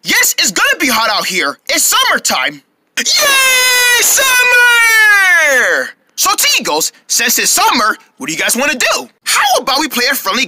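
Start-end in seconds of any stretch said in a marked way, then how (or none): 3.0-5.9 excited cheer. Acted. Kids cheering for summer.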